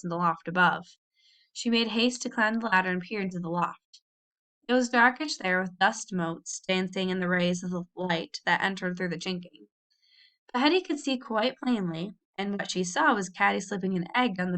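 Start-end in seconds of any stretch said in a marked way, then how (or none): none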